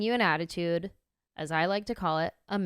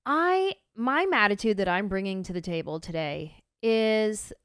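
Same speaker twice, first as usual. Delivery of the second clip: ordinary delivery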